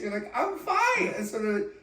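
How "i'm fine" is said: very high squeak; squeaky high voice. Retold or imitated. Mock whale protest.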